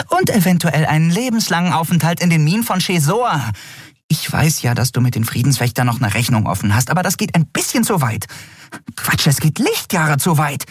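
high, exasperated protest; indignant high-pitched character voice; agitated Nelen. A narrator's reading.

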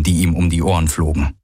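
usual speaking voice

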